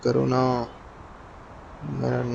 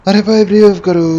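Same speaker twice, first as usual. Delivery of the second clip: urgent shout; 7 semitones higher, 11 dB louder than usual. Real, not acted. Teammate down, calling for revive.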